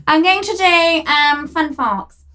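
hammed-up, drawling posh; posh accent. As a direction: stretch the words in a put-on posh accent. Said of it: "to do a posh accent".

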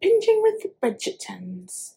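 soft, slow attempt at a British accent; put-on British accent. Shown as a demonstration. Podcast host retrying the show line with an accent, mock British. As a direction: try it in a quiet, drawn-out British accent. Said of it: "if you said it with an accent".

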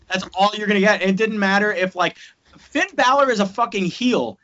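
ordinary talk, nothing marked

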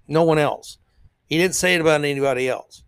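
ordinary talk

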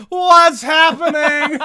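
slow, high mock yell at a house; hammed-up horror movie lady shouting; Poltergeist lady yelling. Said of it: "yelling at the house that is being imploded".

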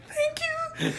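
very high squeak, pretending to nearly cry; mock tearful thanks.

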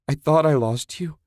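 high, emotional outcry; anguished cry. Acted. Woman to the man she nearly lost, crying out. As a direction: cry out, overcome. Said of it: "Susan cried".

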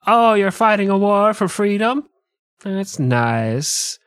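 high feigned interest sinking to a dismissive drop; George Washington's mother. Acted. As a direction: play an unimpressed mother. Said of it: "who was basically like".

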